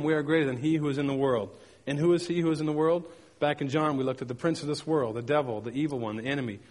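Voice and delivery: normal talking voice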